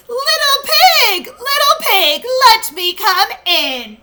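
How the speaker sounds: high, loud wolf call. Acted. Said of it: "in a louder voice he called".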